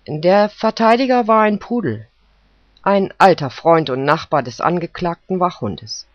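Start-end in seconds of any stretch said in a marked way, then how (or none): none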